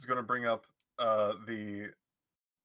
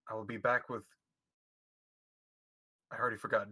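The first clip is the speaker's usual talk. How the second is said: ordinary talk, nothing marked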